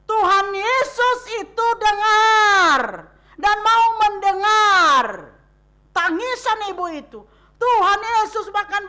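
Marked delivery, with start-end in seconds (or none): 0.1-7.2 slow, loud, near-tearful cry; crying out with emotion. Acted. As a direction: plead it out, voice straining with feeling. Emotional climax of sermon. (berseru keras dengan suara hampir menangis)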